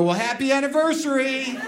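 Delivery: mock-cute anniversary wish; mock sweet toast. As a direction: offer it like a sheepish little gift. His own quote, sheepish.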